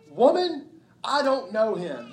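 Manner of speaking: sharp loud denial, pitch jumping up; angry denial. Acted. Peter denying Jesus to the servant girl, loud and sharp.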